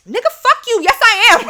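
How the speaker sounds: loud, high, furious shout back; angry yell. Retold or imitated. Yelling back at her partner in the heated argument. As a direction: snap back at him in a rage.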